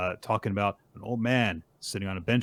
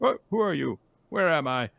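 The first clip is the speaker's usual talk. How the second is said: put-on higher-pitched confused old-man voice; acted startled confusion, pitched up; bewildered old man; 9 semitones higher, 4 dB louder than usual. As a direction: ask it as if suddenly disoriented. Old man realizes he is lost.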